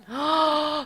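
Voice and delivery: long theatrical intake; dramatic gasp. Pretend. Player watching Whitney's response, dramatic gasp. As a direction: gasp in astonishment, hammed-up.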